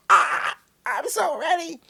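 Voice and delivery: very high-pitched ecstatic squeal; squealing excitement. Retold or imitated. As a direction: shriek it, bursting with excitement. Acts out losing her mind excitedly.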